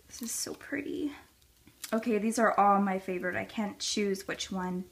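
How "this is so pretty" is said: soft whispered praise; breathy whisper. Real, not acted. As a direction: hush it admiringly. Whispered admiration.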